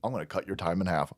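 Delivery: low, soft, fast mock judge. Vlogger imitates the judge halving the time, low and quick. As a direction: mumble it like a pushover judge. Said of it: "And she goes".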